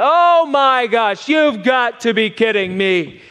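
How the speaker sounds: loud, slow shout of disbelief; loud stretched-out drunk exclamation; drunken yell. Retold or imitated. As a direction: bellow it like a drunk on a plane. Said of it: "He's yelling".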